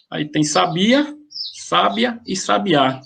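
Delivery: slow, sabia on BI, sábia on SÁ, sabiá on Á; shifting stress demo. Shown as a demonstration. Teacher showing concurso students three stress placements, slow and deliberate. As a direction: spell out sabia, sábia and sabiá slowly, moving the stress each time. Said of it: "são três palavras diferentes, cada uma com uma sílaba tônica diferente".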